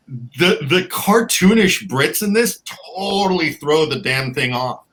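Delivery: ordinary talk, nothing marked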